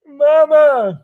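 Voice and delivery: high, loud wail for mama; a little kid wailing for his mother; hammed-up hurt child; mock crying child. Playing kid cut on tin.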